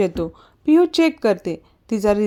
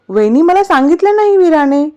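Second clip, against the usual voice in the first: mildly miffed, higher-pitched complaint; acted peevishness, voice pitched up; irritated; 6 semitones higher, 3 dB louder than usual. A narrator's reading. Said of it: "तो चिडून बोलतो".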